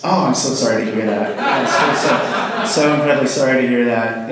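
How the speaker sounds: put-on condolence for laughs; mock sympathy. Friend consoles her after breakup, badly faked sympathy. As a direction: overdo the sorrow, barely hiding delight.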